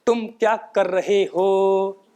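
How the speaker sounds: slow, each sound bounced up and down; bouncy demo of a speech flaw. Shown as a demonstration. Teacher bouncing each syllable to show the flaw. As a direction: hop through the words like a deer. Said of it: "जैसे".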